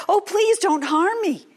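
high, loud frightened plea; pleading. Acted. Nisam begging the swooping eagles, high and frightened. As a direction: beg the eagles not to hurt him. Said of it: "and he said".

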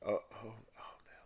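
soft, halting whisper; attempted whisper. Reader practises Severus's whispered line, halting whisper. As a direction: test the whisper in false starts. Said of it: "I literally can't whisper it".

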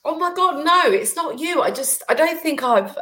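choked up, fighting back tears mid-sentence; emotional. Real, not acted. Roz moved by the host's words, tearful and high. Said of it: "I'm just getting emotional".

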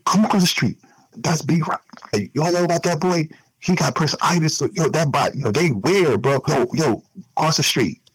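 high, animated imitation of gossiping neighbors; mocking the neighbors. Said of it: "they, they be like".

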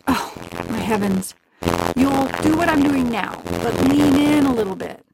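lightly voiced witch. A narrator's reading. Witch showing Gretel how to lean in, mildly exasperated. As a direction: sigh it out, impatient.

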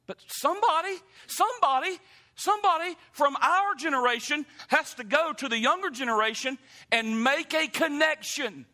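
high, loud rising appeal; preaching at full force. Acted. Preacher urging his church to reach the young, rising. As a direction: call them to action from the pulpit.

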